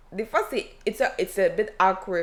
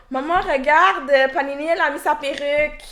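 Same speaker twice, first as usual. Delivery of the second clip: put-on higher, louder kid tattling to mom; excited child voice; 5 semitones higher, 6 dB louder than usual. Child telling parent about wig.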